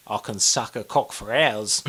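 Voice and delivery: put-on low, soft, slow drunken ask; low drunk come-on. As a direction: murmur it like a swaying drunk. Imitating drunk Aussie boast.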